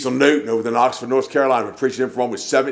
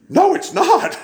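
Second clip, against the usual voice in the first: high-pitched mock protest, laughing; indignant retort; 3 dB louder, much slower than usual. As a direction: squeal the denial way up high, imitating an outraged dog lover. Acted indignant retort about the dog.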